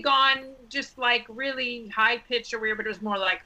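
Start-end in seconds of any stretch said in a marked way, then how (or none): none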